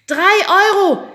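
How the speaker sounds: high, loud, slow group yell; shouting. A narrator's reading. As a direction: yell it through the door. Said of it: "schrien wir wie aus einem Mund".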